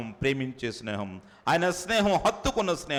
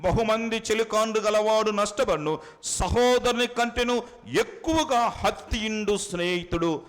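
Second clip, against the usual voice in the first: scripture reading; 6 semitones higher, 3 dB louder than usual. Acted. Preacher reads a proverb aloud to the congregation. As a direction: intone the proverb to the listeners.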